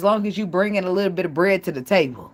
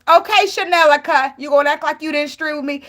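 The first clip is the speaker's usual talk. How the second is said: higher, louder, sassy dismissive Okay; [name]'s voice; 7 semitones higher, 4 dB louder than usual. Retold or imitated. Retelling the reality show clapback, sassy and laughing. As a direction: mimic a reality star cutting someone down. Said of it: "She said".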